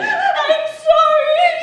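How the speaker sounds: drawn-out tearful sobbing apology; crying. Acted. Wife, scared before the escape, sobbing apology. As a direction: sob it out slowly, voice wobbling high. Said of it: "What are you crying about?".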